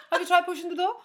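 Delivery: high-pitched mock Scandinavian lilt; generic Scandinavian accent. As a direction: put on a vague Scandi accent. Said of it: "I don't know what accent that is".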